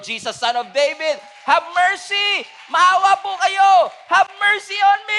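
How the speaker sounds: high, loud desperate shout. Retold or imitated. Blind man shouting over the crowd, loud high desperate. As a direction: scream for mercy, building. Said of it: "So he shouted even more".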